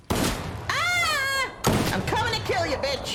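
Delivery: high, loud charge yell at the enemy; battle shout. Real, not acted. Streamer rushing an enemy player, screaming a battle cry.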